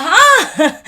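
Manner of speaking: high, loud incredulous huh; shouted high surprised huh. Retold or imitated. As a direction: bark out disbelief. Men react disbelieving.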